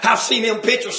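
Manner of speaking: ordinary talk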